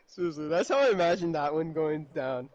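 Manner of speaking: ordinary talk, nothing marked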